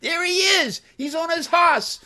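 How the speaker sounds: very high mock yell; imitating yelling kids. Novelist imitates kids at a patriotic reading, mock yelling. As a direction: heckle like a crowd of kids. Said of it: "with the kids yelling".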